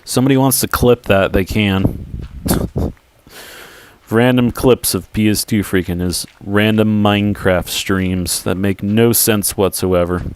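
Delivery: usual speaking voice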